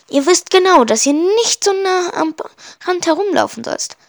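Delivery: high-pitched scolding woman; stern telling-off, pitched well up; shrill woman. A narrator's reading. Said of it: "erklingt eine schrille Stimme hinter ihnen".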